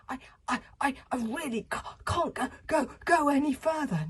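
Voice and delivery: high, broken, gasped stammer; panting, gasping. A narrator's reading. Fishlegs halting exhausted from running, high panting gasps. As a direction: gasp it out, too winded to go on. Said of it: "came to a panting halt and gasped".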